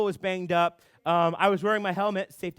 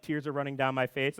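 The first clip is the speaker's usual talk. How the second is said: ordinary talk, nothing marked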